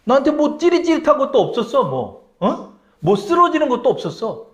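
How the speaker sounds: disappointed grumble. Acted. Disciple complains, nothing happened.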